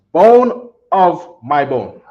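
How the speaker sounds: "Bone of my bone" said slowly, each word set apart; slow model phrase. Shown as a demonstration. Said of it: "So here we go".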